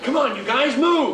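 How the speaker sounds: hustling the guys out the door; urgent shout. Acted. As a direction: yell at them to move. Urging soldiers to hurry out.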